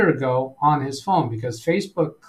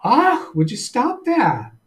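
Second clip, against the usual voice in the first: exasperated protest; 9 semitones higher, slower than usual. Real, not acted. Reacting to an interrupting voice.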